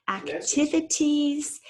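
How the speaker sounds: ACTIVITIES slow and high, every syllable clear; sounded-out word. Shown as a demonstration. Teacher reading a spelling word, ACTIVITIES slowly sounded out.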